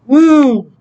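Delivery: high, loud "woo" falling away; loud high whoop. Real, not acted. Reader done with the message, loud high whoop. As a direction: whoop with a big downward slide. Exclaims after finishing a message.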